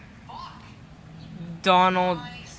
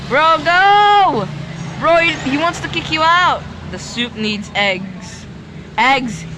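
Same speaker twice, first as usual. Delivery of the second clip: urgent yelling; 4 semitones higher than usual. Real, not acted. Gamer shouting where the eggs are, high and urgent. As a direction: point him there fast.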